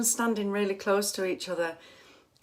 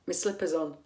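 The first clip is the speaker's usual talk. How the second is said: ordinary talk, nothing marked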